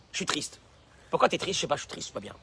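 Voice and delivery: imitation douce et plus basse d'une plainte morose; marmonne-le, abattu, sans élan; plainte triste imitée. Personne triste qui se plaint. (l'homme triste qui se plaint, imité tout bas)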